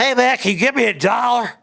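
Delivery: high, loud street voice asking for change; mimicking a beggar asking for a dollar; Southern panhandler. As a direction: beg like a guy on the corner. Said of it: "We're in the South".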